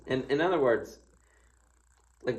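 ordinary delivery

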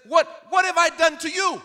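high, loud indignant question; indignant prophet. Acted. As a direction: Elijah snaps back, high and loud. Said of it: "He turns to him and says".

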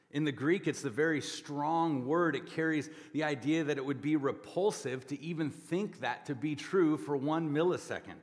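usual speaking voice

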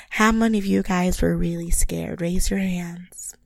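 put-on breathy baby-talk whisper; serious baby voice. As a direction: coo it like a concerned babysitter. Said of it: "serious baby voice".

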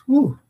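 high sighing whew of relief; relieved whew. Retold or imitated. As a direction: blow out the tension, high. Relief after first tee shot.

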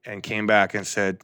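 ordinary talk, nothing marked

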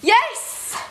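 high, loud burst of delight; excited agreement. Real, not acted. Fan agreeing with co-host's Holy Trinity, excited cry. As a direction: cheer it out in real agreement.

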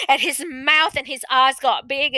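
ordinary talk, nothing marked

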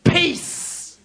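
calm rebuke. Retold or imitated. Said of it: "he says".

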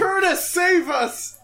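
slow, high pleading cry; mock shout. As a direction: cry out dramatically for rescue.

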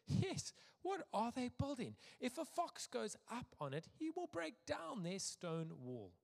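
high-pitched, put-on scoffing voice; mocking jeer. A narrator's reading. Tobiah scoffing at the wall builders, high mocking voice.

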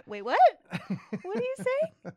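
high, loud, laughing disbelief; retold shocked reaction. Parent retelling a shocked reaction to a child's word, incredulous.

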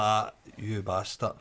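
low, slow, soft "ah"; muttered retold reaction. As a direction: say it slowly under your breath.

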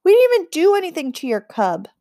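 plead, a little rattled; nervous boy. A narrator's reading. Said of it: "Tin said".

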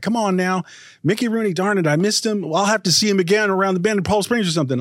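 laid-on country-style put-on accent; thicker put-on accent. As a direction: ham up the accent like an old pal. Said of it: "I put on more of an accent".

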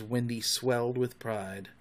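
usual speaking voice

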